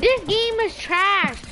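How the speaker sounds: loud, high, slow angry rant; raging gamer. Real, not acted. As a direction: spit it out at the game. Angry at glitching game.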